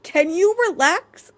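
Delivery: incredulous, fed up; exasperated. Real, not acted. Exasperated retort.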